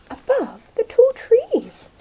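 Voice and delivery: high, slow, floating voice; soaring dreamy. Acted.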